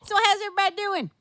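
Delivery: high, loud, brisk granny greeting; grandma voice. Retold or imitated. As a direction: hail the room as a put-on grandma. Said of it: "I was acting as grandma".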